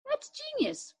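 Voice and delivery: high, delighted exclamation; genuinely amazed; impressed. A narrator's reading. Michael impressed.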